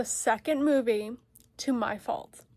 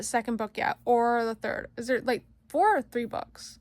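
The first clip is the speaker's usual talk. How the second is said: ordinary talk, nothing marked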